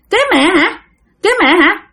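high-pitched questioning spirit; possessed aunt's changed voice. Acted. Spirit in aunt questions child seeking mother, high voice. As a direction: echo it back in a high spirit voice. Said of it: "cái giọng của dì Năm thay đổi liền".

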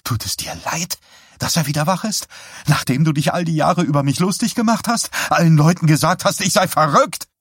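high, fast, building to loud; bitter accusation. A narrator's reading. Wife accuses husband of mocking her for years, bitter. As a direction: start contained, climb into shrill accusation.

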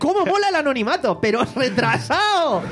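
high, laughing disbelief at "anonimato"; can't believe he wrote that, laughing. Real, not acted. (incredulidad entre risas, voz más aguda)

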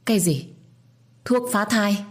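shocked disbelief. A narrator's reading. Hearing of abortion drug.

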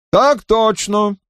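высоко, медленно, чеканя; чеканит с издёвкой; отчеканил. A narrator's reading. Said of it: "отчеканил Роуклифф". (чётко отчеканил)

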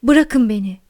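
loud outburst in a strange voice; angry cry. A narrator's reading. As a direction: cry out, demanding to be left alone. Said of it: "diye haykırdı". (öfkeyle haykırarak)

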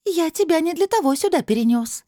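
high, loud, grating reproach; grumbling crystal voice. A narrator's reading. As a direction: grumble in a high voice like knife on glass. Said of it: "проворчал голос". (высоким ворчливым голосом)

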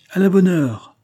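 high, loud, gleeful approval; merry royal exclamation; cheerful. A narrator's reading. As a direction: exclaim it gaily, pitched well up. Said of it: "reprit gaiement le roi".

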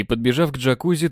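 usual speaking voice

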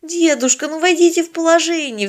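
put-on high, imploring girl's voice; pleading. A narrator's reading. Polina begging the old man for help, pleading. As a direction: beg him to understand. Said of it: "взмолилась Полина".

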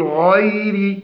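غير with a light ghayn and a thin ra; correct ghayn. Shown as a demonstration. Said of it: "فنقول". (ترقيق الغين في غير)

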